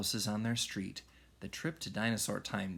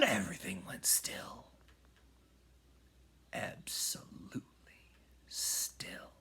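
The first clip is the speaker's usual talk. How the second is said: hushed whisper after the storm; sudden hush as everything stops; 7 semitones higher, 7 dB quieter than usual. A narrator's reading. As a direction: drop to a breath. Sudden stillness after spin.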